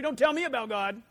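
loud, dismissive retort; a stranger brushing off a preacher, loud and annoyed; irritated snap. Acted. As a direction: slam the door on him. Rude brush-off, louder end.

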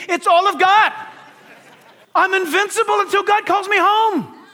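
high, emphatic proclamation of faith; triumphant declaration. Retold or imitated. Paul answering those who would stop him, triumphantly defiant.

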